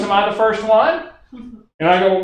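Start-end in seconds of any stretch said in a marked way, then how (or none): 0.0-1.1 higher, eager question; Barbara's voice. Retold or imitated. Barbara asks Steve if she is first, higher voice. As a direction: lift it up hopefully. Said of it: "I'm gonna talk like Barbara for a minute".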